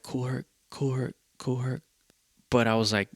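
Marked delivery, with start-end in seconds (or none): 0.0-1.8 inner voice. Retold or imitated. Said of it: "and in my head, I'm like".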